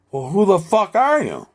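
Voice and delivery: high, loud, slow put-on voice; mock tough challenge. The imagined voices demanding who Rob is, loud and slow. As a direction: challenge him like a hammed-up thug. Speaker voices the other side questioning.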